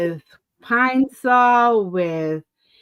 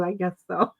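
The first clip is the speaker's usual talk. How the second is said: sheepish mumble; 4 semitones lower, 6 dB quieter than usual. Retold or imitated. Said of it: "I go".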